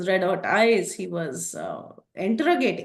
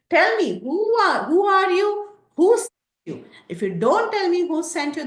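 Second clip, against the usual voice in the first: much higher, louder angry shout; furious demand; 10 semitones higher, 4 dB louder than usual. Acted. Prahasta demanding Hanuman reveal who sent him, furious high shout. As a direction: bark the questions at the intruder.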